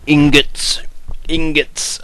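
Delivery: loud, high mock foreign announcement of Ingots!; mock Slavic name. Shouting the word as if it were a Slavic name. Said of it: "could be like a Slavic name".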